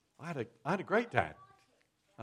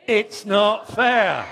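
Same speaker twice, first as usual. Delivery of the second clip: loud, high, slow it's not fair; really milk the whine, drawn out; big sulky wail; 9 semitones higher, 12 dB louder, much slower than usual. Shown as a demonstration. Shows the child how to sulk, loud and drawn out. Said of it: "Let's just try that again".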